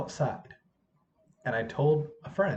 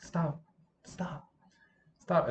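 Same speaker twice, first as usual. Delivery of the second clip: each stop softer till whispered; fading to a whisper; 5 semitones higher, 4 dB quieter, much slower than usual. Retold or imitated. Speaker quietly says stop.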